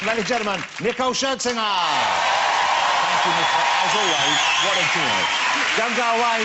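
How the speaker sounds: high, projected presenter sign-off; show host announcement. Real, not acted. Announces guest and break.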